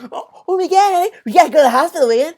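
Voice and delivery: high, loud mock panic; panicked. Streamer imagines the son panicking over his girlfriend Kat, high and loud. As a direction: gush with fake alarm. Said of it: "He'd probably".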